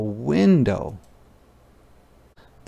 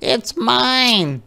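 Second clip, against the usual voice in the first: put-on high, lightly raised girl's yell; mild yell; 13 semitones higher than usual. Ruthie yells at Martin, a mild high yell. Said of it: "she yelled".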